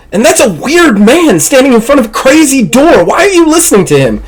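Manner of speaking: high-pitched exasperated protest; agitated guard, incredulous and high; heated quarrel. Acted. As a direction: argue with your partner, voice climbing. Gunmen quarrel over the stranger.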